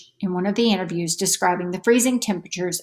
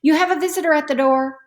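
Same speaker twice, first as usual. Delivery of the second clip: higher call across the house; 8 semitones higher, faster than usual. A narrator's reading. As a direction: call her daughter from the other room. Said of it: "Mom called out".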